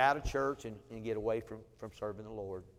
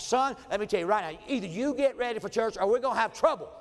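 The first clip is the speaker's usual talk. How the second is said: higher and louder stern ultimatum; fed-up father; 7 semitones higher, 5 dB louder than usual. Retold or imitated. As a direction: lay down the law to a dawdling son. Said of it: "I just had enough".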